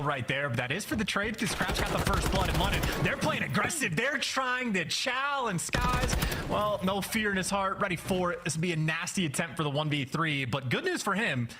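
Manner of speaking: high-pitched live esports call; urgent commentary riding the action; excited play-by-play. Real, not acted. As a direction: push the pitch up as the fight unfolds.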